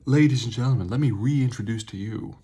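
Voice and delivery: loud showman's introduction; announcer introduction. Pretend. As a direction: announce it big, like a ringmaster. Grand introduction of the drink.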